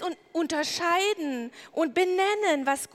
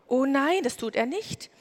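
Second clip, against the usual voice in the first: ordinary delivery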